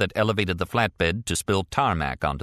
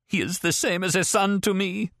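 much higher, frail tearful plea; sobbing; 11 semitones higher than usual. A narrator's reading. Grieving woman sobs to the oligarch, high and tearful. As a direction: sob it out like a grieving mother. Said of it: "the woman sobbed".

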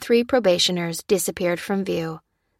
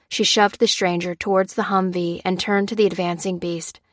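ordinary delivery, nothing marked

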